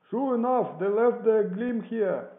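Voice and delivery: high, slow, loud holler; calling down. A narrator's reading. Fellow at the window reporting a find, loud shout.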